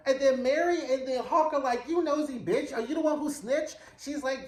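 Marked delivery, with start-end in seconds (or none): 1.9-3.8 higher, outraged accusing; mock outraged gasp at a snitch; indignant accusing. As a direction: call out the snitch, scandalised. Confronting the nosy secretary.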